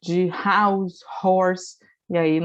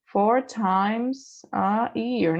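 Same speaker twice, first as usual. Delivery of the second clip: once a day spelled out very slowly, word by word; careful, drawn-out model phrase; slow teaching demo; much slower than usual. Shown as a demonstration. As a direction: stretch each word so students can copy. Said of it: "né?".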